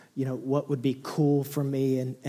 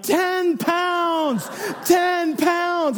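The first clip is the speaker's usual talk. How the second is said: much higher, louder triumphant yell; excited shout; 5 dB louder, much slower than usual. Retold or imitated. Dad announces baby's weight to family, shouting triumphantly. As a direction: shout it down the hall like a champion. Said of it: "I'm like".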